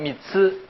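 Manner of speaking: 미츠 with 츠 between 스 and 쯔; 츠 in 미츠 as a careful Japanese tsu; difficult Japanese tsu. Shown as a demonstration. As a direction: say 미츠 slowly, the last sound neither plain s nor hard jj. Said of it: "스도 아니고, 쯔도 아니고".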